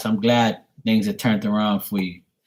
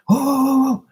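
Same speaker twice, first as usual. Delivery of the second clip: much higher yelping oh sounds like a barking dog; dog alert yelps; 3 dB louder than usual. Retold or imitated. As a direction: squeal it out like a dog sounding the alarm. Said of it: "she'd be like".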